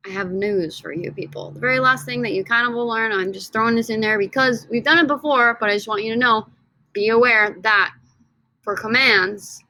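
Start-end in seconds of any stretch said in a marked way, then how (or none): none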